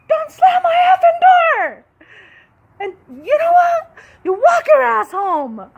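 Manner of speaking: slow, loud, high-pitched angry yell; furious shout. Retold or imitated. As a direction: bellow it at the kid who slammed the door. Yelling at daughter from car.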